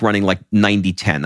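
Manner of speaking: ordinary delivery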